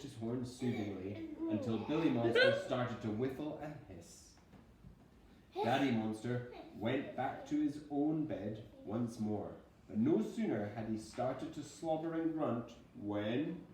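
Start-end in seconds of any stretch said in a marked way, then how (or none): none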